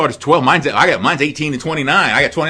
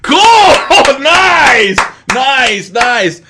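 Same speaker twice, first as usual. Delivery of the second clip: mock delighted; 11 semitones higher, 9 dB louder, much slower than usual. Mock reaction, laughing.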